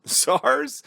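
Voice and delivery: high rising disbelief; incredulous. Real, not acted. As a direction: ask it mockingly stunned. Mocking the outdated disease.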